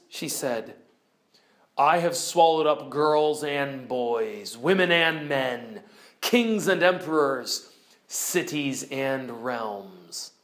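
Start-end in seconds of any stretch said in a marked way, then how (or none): 1.8-10.3 lower, very slow, grave lion; solemn lion. Acted. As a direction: weigh each word heavily. Lion's grave answer.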